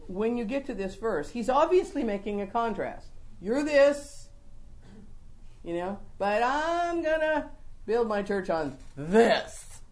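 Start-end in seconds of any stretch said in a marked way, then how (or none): 3.4-4.3 high, loud "this", setting up a contrast; "this" held up high as the first half of a contrast; stress on this. Shown as a demonstration. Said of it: "Emphasis on my word this". 6.2-9.8 slow build to a weighty final "this"; stress on this. Shown as a demonstration. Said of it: "Emphasis on my word this".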